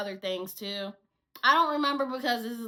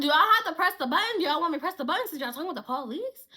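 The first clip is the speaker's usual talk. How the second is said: quick, raised-voice retold query; urgent question; 5 semitones higher, 5 dB louder, faster than usual. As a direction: fire it off fast, rising at the end.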